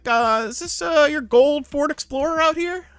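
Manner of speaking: high put-on cop voice; detective's questioning. Detective asking about a car, mimicked high. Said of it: "He was like".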